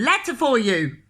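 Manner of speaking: loud, high shouted morning. Acted. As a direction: call it out at the door. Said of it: "shouted the postman".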